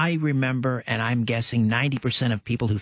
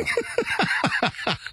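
high-pitched burst of laughing; real laughter. Real, not acted. Host laughing after hearing it's called Jif, real laughter. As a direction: break up laughing.

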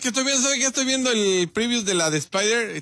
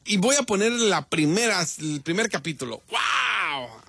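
exaggerated, higher-pitched fake excitement; sarcastic enthusiasm; 5 semitones higher than usual. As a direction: overplay being thrilled on command. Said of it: "pero sin sarcasmo".

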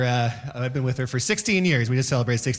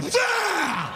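high shouted sudden sneeze; old man sneeze. Retold or imitated. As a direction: blast out a sneeze. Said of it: "old man sneezes".

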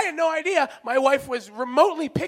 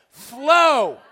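louder disgusted FLOW; shouted repeat; 5 dB louder than usual. Shown as a demonstration. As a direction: blurt Flow! in horror.

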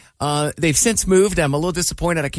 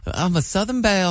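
teasing Southern belle voice, a touch higher; mock Southern belle; 5 semitones higher, 3 dB quieter than usual. Teasing co-host about her wealthy accent, mock Southern belle. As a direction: lightly play a prissy Southern belle. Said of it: "you turn on that Southern accent".

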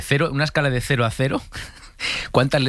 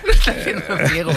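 higher, louder mocking repeat; mock hater; 6 semitones higher, 4 dB louder than usual. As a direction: throw the hater's words back louder.

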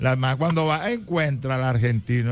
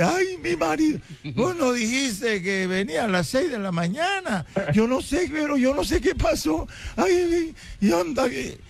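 much higher frantic woman's voice; panicked wife; 12 semitones higher than usual. Retold or imitated. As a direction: squeal like a caught wife. (esposa asustada, voz mucho más aguda)

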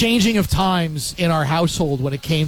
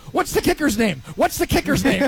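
higher mock shout across the room; retold excited yell; 7 semitones higher than usual. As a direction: call it out high like a giddy prank caller. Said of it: "yelling to us".

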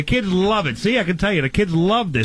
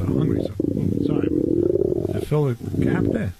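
a deep mock voice while craving more; deep put-on voice; 8 semitones lower than usual. Low put-on voice, eating.

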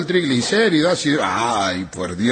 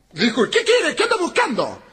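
wife snapping; 11 semitones higher than usual. Retold or imitated. Wife snaps from balcony.

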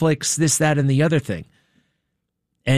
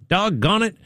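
lightly imitated dog owner, higher pitch; higher, innocent-sounding mock drawn from a tape; calm, benign; 6 semitones higher than usual. Host imitates accused man calling his dog, calm and benign. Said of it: "voice was so, like, kinda calm".

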